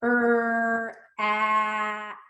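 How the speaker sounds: "Er, at" with each sound held and separate. Shown as a demonstration. Teacher touches letters for children, "Er, at" sounded out. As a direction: stretch "Er", then "at", sound by sound. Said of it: "touch and say our sounds".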